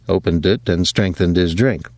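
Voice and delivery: ordinary delivery, nothing marked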